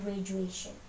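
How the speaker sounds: softly modelled "Graduation", DU as J; pronunciation demo. Shown as a demonstration. Teacher shows how it sounds, soft "Graduation" with J. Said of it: "Kedengarannya kayak J".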